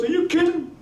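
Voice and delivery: high, incredulous raving; imitated excited fan. Fan raves about his friend's greatest night, excited. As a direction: rave it high, as if amazed he'd ask.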